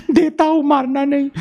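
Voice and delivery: high, frightened giving in; scared pleading. Retold or imitated. Friend held by the collar begs not to be hit, frightened. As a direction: plead like a kid who just got slapped.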